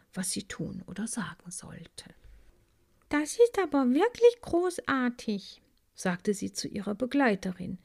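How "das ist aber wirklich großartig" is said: put-on high, wide-eyed young bee; a higher, delighted little bee voice; amazed little bee. A narrator's reading. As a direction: marvel at it like a child.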